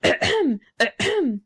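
acted, irritated little cough; angry ahem. A narrator's reading. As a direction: clear the throat twice to break in, annoyed. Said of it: "said the Rocket angrily".

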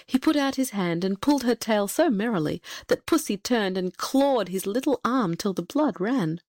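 ordinary delivery

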